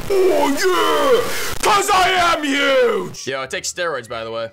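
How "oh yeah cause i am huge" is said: loud, high and slow; loud boast. Pretend. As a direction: bellow it like a bodybuilder. Bragging about looking huge.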